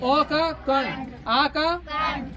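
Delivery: very slow, each sound stretched; slow teacher dictation; slow demo. Shown as a demonstration. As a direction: spell it out slowly for pupils. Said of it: "teacher drawing out sounds".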